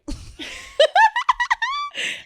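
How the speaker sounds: squealing burst of laughter; high shrieking laugh. Real, not acted.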